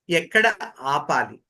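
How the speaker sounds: normal talking voice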